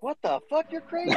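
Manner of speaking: high squeaky disbelief; retelling a shocked reaction. Retold or imitated. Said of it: "And I was like".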